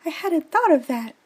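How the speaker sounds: put-on a young girl caught off guard; Juliet's voice. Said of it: "she said".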